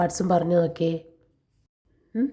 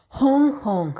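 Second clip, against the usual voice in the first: a louder, higher honk honk; bus horn honk; 5 semitones higher, 4 dB louder than usual. Acted. Teacher voicing the bus honking at the car. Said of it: "bus horn മുഴക്കിയിട്ട് പറഞ്ഞു".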